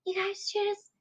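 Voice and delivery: high, put-on feminine voice; feminine character voice. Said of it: "Oh my God".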